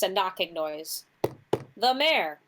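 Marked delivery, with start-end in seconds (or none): 1.8-2.4 light mimic of the innkeeper; a touch of parroting the woman; imitating the woman. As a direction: echo her line in her voice. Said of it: "Candle says in the exact same voice as this woman".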